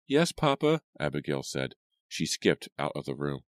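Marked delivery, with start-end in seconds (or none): 0.1-0.8 bright high "Yes, Papa"; girl's voice. A narrator's reading. Abigail answers her father.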